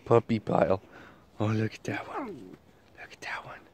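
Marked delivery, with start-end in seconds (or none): 1.4-3.6 hushed, whispered aside. Real, not acted. Pointing out one pup in the puppy pile, whispering. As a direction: whisper it, very soft.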